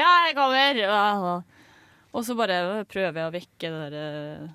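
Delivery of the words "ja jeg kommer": drawn-out call toward the door, louder. Retold or imitated. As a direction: shout back slowly that you are coming. Answering loud knocking at door.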